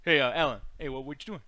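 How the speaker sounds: imitating a friend's hard-to-follow talk; nineties. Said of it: "this dude talks like this".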